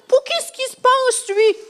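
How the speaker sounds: high-pitched, drawn-out mock outrage; indignant Pharisees. Storyteller voicing Pharisees angered by Jesus' claim, indignant. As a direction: protest in exaggerated, indignant disbelief. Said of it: "ça mettait les pharisiens en colère".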